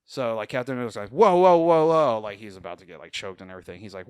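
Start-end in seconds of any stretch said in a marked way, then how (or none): 1.1-2.2 alarmed. Retold or imitated. Captain America about to be choked.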